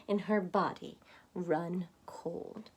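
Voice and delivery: slow, whispered dread; chilling dread. A narrator's reading. Reading a scary moment to children, whispered dread. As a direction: let it go cold and hushed.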